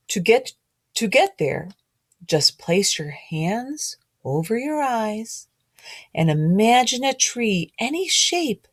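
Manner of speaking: storybook read-aloud, gently rhythmic; reading to children. A narrator's reading. Teacher reading a picture book rhyme to children.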